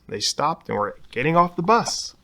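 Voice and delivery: plain reading voice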